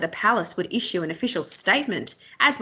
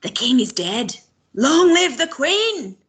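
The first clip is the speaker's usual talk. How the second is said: drawn-out call, louder and higher at the end; old woman gives it everything she has left; calling with all her breath; 6 semitones higher, 5 dB louder than usual. A narrator's reading. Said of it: "with all the breath she could muster".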